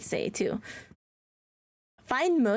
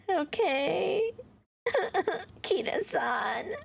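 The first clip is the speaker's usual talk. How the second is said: tearful; 6 semitones higher, much slower than usual. Real, not acted. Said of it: "Oh, I'm gonna cry".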